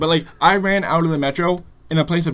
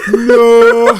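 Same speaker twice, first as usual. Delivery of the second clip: laughing no; 11 dB louder than usual. Retold or imitated. Girlfriend's voice, laughing.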